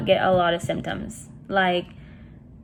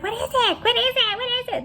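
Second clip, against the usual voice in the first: high-pitched clamouring children's imitation; excited kids; faster than usual. Imitating the two youngest asking, high excited kids. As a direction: pipe up like eager little kids.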